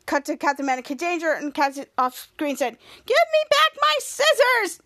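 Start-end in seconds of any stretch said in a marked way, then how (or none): 3.1-4.8 very high-pitched, slow demand; shrieky mock-furious girl demanding; acted angry yell. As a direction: yell it out, stretching the words. Cassie yells from off screen.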